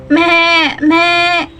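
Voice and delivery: playful bleating meh meh; goat bleat. Shown as a demonstration. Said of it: "what the sound of a goat".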